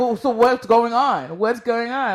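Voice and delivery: loud, slow eager asking; curious demanding. Retold or imitated. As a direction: ask eagerly, drawn out. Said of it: "people's like".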